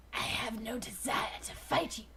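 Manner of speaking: low, soft rumbling refusal; a deep grumbling brush-off; rumbled. Acted. Dinobot rebuffs Rat Trap, rumbling low and soft. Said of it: "Dinobot rumbled".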